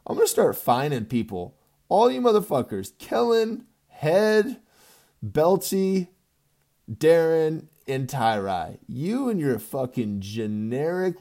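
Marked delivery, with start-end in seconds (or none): 1.9-8.8 high, loud roll call of names; shouting down the list. Real, not acted. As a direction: bark each name out. Calling out league members by name.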